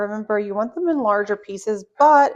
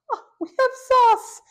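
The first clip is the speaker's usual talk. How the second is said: surprised; 4 dB louder than usual. Pretend. Pretend discovery of the sauce.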